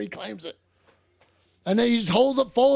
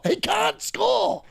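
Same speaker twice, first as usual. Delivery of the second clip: much higher and slow, stretching "score"; drawn-out mock wail; 11 semitones higher, much slower than usual. Real, not acted. Fan mocking the striker, now in a drawn-out high wail.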